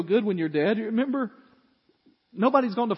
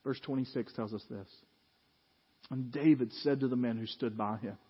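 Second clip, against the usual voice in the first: ordinary delivery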